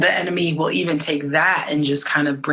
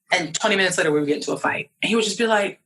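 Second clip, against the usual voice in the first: usual speaking voice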